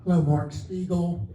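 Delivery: ordinary talk, nothing marked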